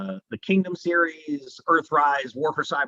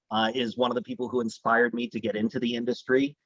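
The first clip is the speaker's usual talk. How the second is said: ordinary talk, nothing marked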